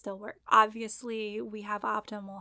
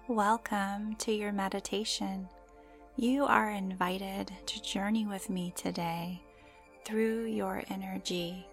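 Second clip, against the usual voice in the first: guided meditation; slower than usual. Acted. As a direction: gently guide the listener into calm.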